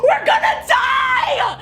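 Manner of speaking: high, slow, laughing yell; laugh-yelling in panic; terrified shout. Retold or imitated. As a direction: wail it out in mock terror. Terrified outburst.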